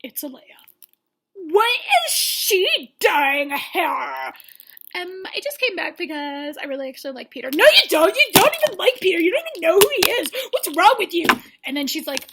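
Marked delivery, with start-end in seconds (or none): 1.3-4.3 mock the contestants' outrage; hammed-up furious yell; indignant shout. 7.5-11.3 high, loud, fast attack; hammed-up catfight shouting; mock angry yelling. Contestants attacking newcomer.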